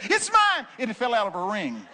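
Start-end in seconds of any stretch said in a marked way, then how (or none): 0.0-0.7 high, loud woman's cry. Retold or imitated. Woman crying out to the preacher, high squeal. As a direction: squeal it like a woman grabbing her diamond. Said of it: "and the woman said".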